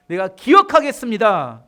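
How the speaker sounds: loud, emphatic declaration; determined vow. Acted. Preacher voices David's resolve to remember, determined vow. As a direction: proclaim it with full resolve. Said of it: "이런 결단하는 겁니다".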